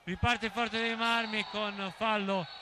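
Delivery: ordinary talk, nothing marked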